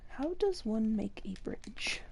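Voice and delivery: whispered question to herself. Real, not acted. Crafter whispering to herself over her project. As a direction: breathe it out, puzzling over the craft.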